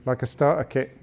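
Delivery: usual speaking voice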